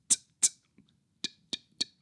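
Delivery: soft clean "t" with no hiss; quick crisp t release. Shown as a demonstration. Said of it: "Nice and crisp".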